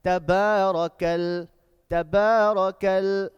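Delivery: slow, loud recitation with stretched vowels; measured, sustained tajweed delivery; loud Quran recitation. Shown as a demonstration. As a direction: chant it out word by word for a class to repeat. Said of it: "Recite it loudly".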